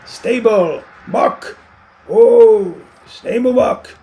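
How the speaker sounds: high, loud call from far away; hollering for the stable buck; distant call. A narrator's reading. A man outside loudly calling for the stable buck. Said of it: "A call came".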